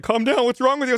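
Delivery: high-pitched retelling of the wife's plea; wife's alarmed plea. Retold or imitated. Wife to screaming husband after the crash, high mock plea. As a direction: imitate a wife urgently trying to calm her husband. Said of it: "she was like".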